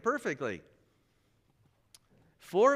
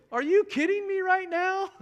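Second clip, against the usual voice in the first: high, loud "are you kidding me"; astonished disbelief; 8 dB louder than usual. Retold or imitated. Colleague stunned by the news, asking in loud high disbelief. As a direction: blurt it out in sheer disbelief.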